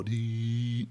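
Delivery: long held, level "dee"; imitated dial tone. Showing young listeners what old phones did, droning tone.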